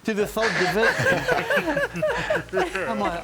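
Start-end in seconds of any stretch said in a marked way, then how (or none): 1.1-2.8 a high, disbelieving laugh. Real, not acted. Laughing at own story.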